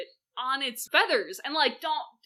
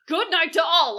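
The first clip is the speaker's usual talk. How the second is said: loud, high, curt farewell; emphatic dismissal; 7 semitones higher, 11 dB louder than usual. Acted. Lady Macbeth dismissing the guests, loudly firm. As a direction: send the whole room packing. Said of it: "her best line read".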